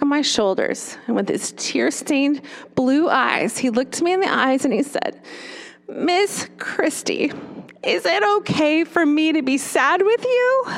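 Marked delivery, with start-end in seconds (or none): 5.9-10.7 put-on high, slow, small child's voice; little boy asking. Tearful little boy asking his teacher, high and timid.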